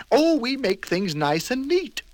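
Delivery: gushing over neatness; fussy delighted character; high prim voice. Acted. Mister Neat beams.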